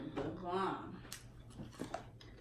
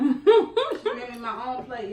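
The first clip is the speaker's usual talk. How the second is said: laughing; 6 semitones higher, 12 dB louder, slower than usual. Real, not acted. Laughing while telling plate story.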